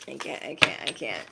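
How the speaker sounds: breathless, fading into whispered "I can't"; real giggle fit. Real, not acted. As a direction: lose it laughing and run out of air.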